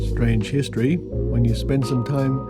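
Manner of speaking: normal talking voice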